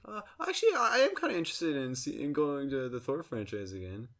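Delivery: high-pitched, hesitant mock interviewee; imitated interviewee. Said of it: "There was this interview with her".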